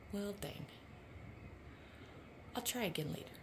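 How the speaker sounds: hushed, stern mother-bird warning; a lightly voiced protective bird mom. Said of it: "the bird is saying".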